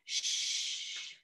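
soft unvoiced shh; a long, soft whispered shh; shushing. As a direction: hush the room gently. Walking gently to bed.

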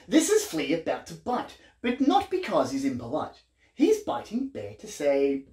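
lively, sing-song picture-book reading; reading to children. A narrator's reading. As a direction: read it like a bedtime book for little ones.

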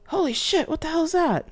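high, loud alarmed exclamation; startled outburst. Acted. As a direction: blurt it out in shock at the sight. Crew startled by huge ship.

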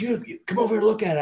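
high, fast taunting teammate voice; mocking dare. Teammate goading the rookie to peek, high fast taunt. Teammate daring him to look.

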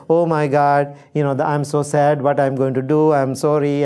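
normal talking voice

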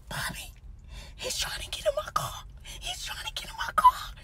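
high, frantic whisper; terrified hushed alarm; panicked whisper. Retold or imitated. Man trying to get in car.

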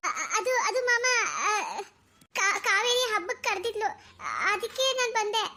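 high, halting excuse; nervous stammering. Acted. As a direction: stumble over the words as if caught out. Caught girl stammers an excuse.

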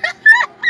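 sudden high-pitched laugh; high burst of laughter. Real, not acted. Laughing at cousin's grocery blunder, high burst of laughter. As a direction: crack up high.

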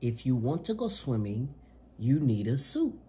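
bright, lively picture-book reading voice; reading to children. Acted. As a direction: read it to little kids with gusto. Reads picture book aloud.

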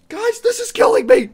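high, loud anguished yell of "killing me"; anguished shout. Real, not acted. Gamer cracking under the wait for her answer, anguished shout. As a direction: let the suspense burst out as a cry.

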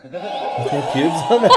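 drawn-out echo through laughter; laughing mimicry. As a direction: repeat it slowly, cracking up. Echoing the clip while laughing.

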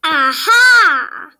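loud, ringing cry of discovery; triumphant shout. Acted. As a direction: shout it like the case is cracked.

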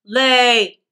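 loud commanding bark; giant's shout. Acted. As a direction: shout the order. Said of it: "and shouted".

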